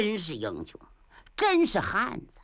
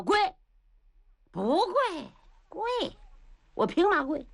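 rising defiant protest, pitched up; defiant refusal; 9 semitones higher than usual. Acted. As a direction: spit out each refusal like a challenge. Luo Feng refuses to kneel.

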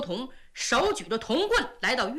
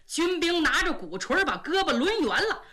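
plain reading voice

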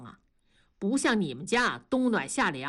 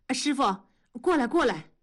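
urgent call, slightly raised and higher; hurried call; 4 semitones higher, faster than usual. A narrator's reading. Tongzhi quickly calls his master over, hurried call. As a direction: call someone over in a hurry. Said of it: "同志赶紧喊".

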